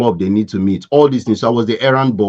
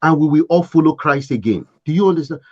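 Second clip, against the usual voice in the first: begging; 7 semitones higher, faster than usual. Retold or imitated. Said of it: "She was begging".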